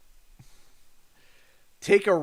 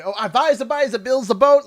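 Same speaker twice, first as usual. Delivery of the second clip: louder, higher put-on Newfoundland accent; 7 semitones higher, 9 dB louder than usual. Streamer mocking how Newfoundlanders talk, Newfoundland accent. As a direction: ham up a Newfoundland fisherman's dialect. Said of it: "like, Newfoundland".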